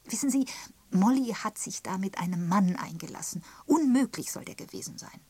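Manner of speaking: high, emphatic gossip; gossiping Miss Prescott. Acted. Miss Prescott telling of Molly's unsuitable man, gossipy. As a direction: confide a scandal, hitting the juicy word hard.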